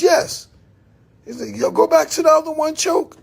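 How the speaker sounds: high, fast excited friend; voicing Jay. Jay asking to see the other one, high and quick. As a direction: rattle it off, high and hyped.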